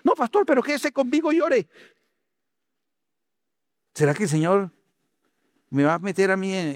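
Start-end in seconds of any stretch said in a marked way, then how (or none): 0.0-1.7 pleading churchgoer. Retold or imitated.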